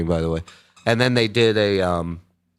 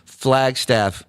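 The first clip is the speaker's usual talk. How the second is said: usual speaking voice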